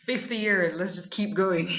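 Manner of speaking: loud, high, cheery voicing of the show; mock eager cheer. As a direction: belt it out like the show itself is eager. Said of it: "It was like".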